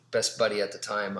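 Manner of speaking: ordinary talk, nothing marked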